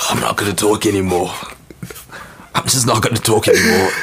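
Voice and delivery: strained voice after coughing; rough throat after coughing fit; hoarse after coughing. Real, not acted. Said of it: "I can't even talk right now".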